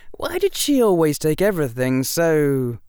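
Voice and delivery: ordinary delivery, nothing marked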